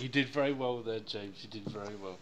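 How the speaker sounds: ordinary talk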